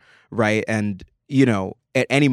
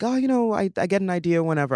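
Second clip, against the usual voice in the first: [name] voice; 9 semitones higher, faster than usual. Retold or imitated. Retelling an interview, slipping into a high [name] voice. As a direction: lift into a high, light pop-star voice. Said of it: "I almost did a [name] voice".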